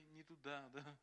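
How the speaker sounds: usual speaking voice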